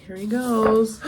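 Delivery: ordinary delivery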